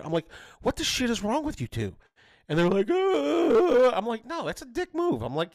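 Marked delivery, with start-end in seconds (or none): 0.6-2.0 high-pitched retold exasperation; pissed-off dad. Dad scolds fighting kids, retold exasperation. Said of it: "I was so pissed at them". 2.9-3.9 high, loud imitated whiny noise; mock kids' whine. Kids answer dad's scolding, mock whine. 4.3-5.2 high-pitched retold scolding; pissed-off dad. Dad scolds fighting kids, retold exasperation. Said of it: "I was so pissed at them".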